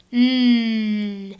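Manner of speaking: tongue tip up behind the teeth, a held N; N sound. Shown as a demonstration. Teacher modeling the N sound for kids, a held front N. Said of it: "Make the sound that N makes for me".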